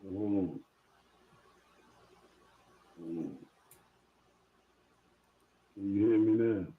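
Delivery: faint and far from the mic; barely audible. Real, not acted. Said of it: "We, we can hardly hear you".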